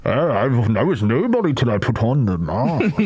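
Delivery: lightly voiced Bane; muffled villain through a mask, laughing. Shown as a demonstration. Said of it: "Bane from Batman".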